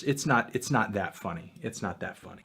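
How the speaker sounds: hushed, flat it's-not-funny; muttered denial. Real, not acted. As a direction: talk yourself down quietly. Muttering to stay serious.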